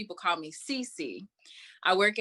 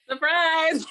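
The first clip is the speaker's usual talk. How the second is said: laughing surprise; 9 semitones higher, 7 dB louder than usual. Real, not acted. Guest laughs at host's squeal.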